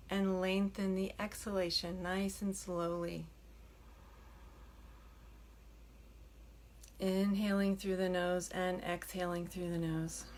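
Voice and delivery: soft, unhurried meditation voice with long pauses; hushed, settling delivery, leaving room to breathe; guided meditation. Acted. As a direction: gently guide them into stillness. Leads a meditation.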